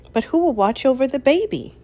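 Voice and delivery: anxious question. A narrator's reading. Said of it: "asked Lady".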